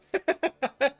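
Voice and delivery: high giggle; real laugh. Real, not acted. Player amused by failed Swedish accent, real laugh. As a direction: crack up at your own silly attempt.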